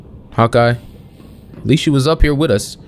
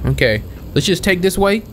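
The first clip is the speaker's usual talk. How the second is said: normal talking voice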